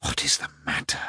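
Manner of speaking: breathless whisper of the question. A narrator's reading. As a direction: whisper it, hushed and tense. Whispered question after quarrel.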